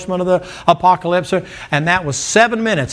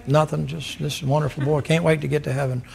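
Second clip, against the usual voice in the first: quiet contented hint; 2 semitones lower, 7 dB quieter than usual. Acted. Acted dinner table chat.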